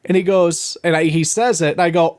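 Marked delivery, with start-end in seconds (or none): none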